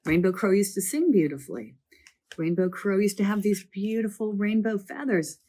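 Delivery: normal talking voice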